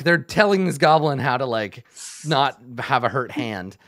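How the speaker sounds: ordinary delivery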